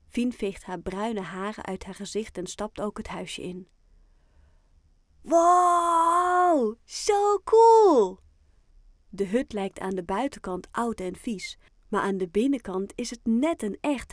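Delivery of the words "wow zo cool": high, loud, long-drawn wow; amazed delight. A narrator's reading. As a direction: gush loudly at a wonderful discovery. Character amazed inside the hut. (vol bewondering uitgeroepen)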